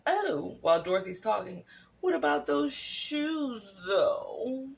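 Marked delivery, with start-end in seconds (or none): 0.1-0.6 high sly interjection; a fake innocent gasp of an oh; Glinda imitation. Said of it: "decides to go". 2.0-4.7 high, slow, sly mock voice; Glinda imitation. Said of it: "decides to go".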